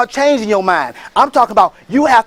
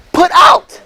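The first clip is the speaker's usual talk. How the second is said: emphatic; 7 semitones higher, 5 dB louder than usual. Real, not acted. Recalling the family's evictions.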